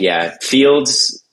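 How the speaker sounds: usual speaking voice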